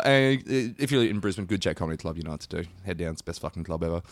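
normal talking voice

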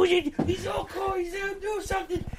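very high, thin silly cooing; mock a thrilled friend in a squeaky voice; Rob's stupid voice. Storyteller mimics his friend admiring the snake, stupid high voice. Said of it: "And Rob is like".

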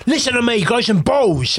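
loud, high-pitched hard-chef dressing-down; Ramsey. Retold or imitated. Customer ordering owner to toughen up, forceful chef tirade. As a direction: imitate a TV chef giving orders. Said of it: "I went all Ramsey on him".

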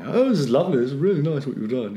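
a hint of a jolly middle-class guest; middle-class gushing. Middle-class audience praising the fete, lightly mocked. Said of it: "middle class sort of people going".

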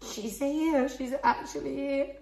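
put-on the moment she first saw the baby; awed whisper. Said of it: "you just went".

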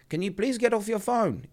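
high-pitched put-on Indian accent. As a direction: imitate an Indian attendant on the tannoy. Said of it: "He was Indian".

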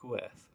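"Gwerth" as a soft, falling "weth"; chosen Welsh pronunciation. Shown as a demonstration. Film fan repeating the Welsh title, soft settled pronunciation. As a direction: say "Gwerth" quietly, like "weth", and let it fall. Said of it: "is how I'm choosing to say it".

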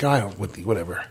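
a muttered brush-off, barely voiced; dismissive mumble. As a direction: mumble it away like it's nothing. Brushing off a warning.

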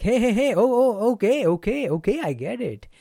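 high, energetic hey and okay, easing by I get it; high eager okays to show you got it; matching their energy. Shown as a demonstration. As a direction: rise up to meet them, then settle. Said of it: "That is matching their energy".

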